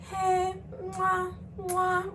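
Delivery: high sweet hello with air kisses; mock-affectionate air-kiss hello; fake-friendly greeting.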